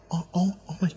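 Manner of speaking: soft, fumbling, embarrassed stammer; flustered. Retold or imitated. Caitlyn uneasy in the brothel, a soft flustered stammer. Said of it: "she was, like, very uncomfortable".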